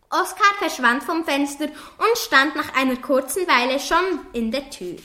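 usual speaking voice

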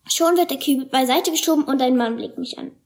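ordinary delivery, nothing marked

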